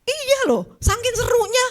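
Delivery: excited telling. Real, not acted. Telling gossip scene animatedly.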